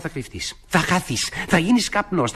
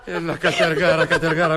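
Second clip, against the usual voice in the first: playful words through a relieved laugh; flirtatious chuckle; slower than usual. Acted. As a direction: tease fondly while chuckling. Flirting after a kiss scare.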